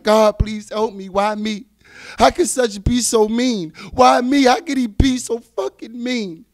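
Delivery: loud, slow despairing cry; slow loud anguished crying out; anguished plea. Retold or imitated. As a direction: ask God why, breaking and loud. Crying out to God in pain.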